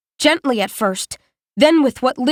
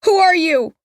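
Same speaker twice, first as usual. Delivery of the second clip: desperate; 7 semitones higher, 3 dB louder than usual. A narrator's reading. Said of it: "the desperation they carry".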